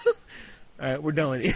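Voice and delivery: laughing through the words; words squeezed out through giggles; stifled giggles. Real, not acted. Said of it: "couldn't keep a straight face".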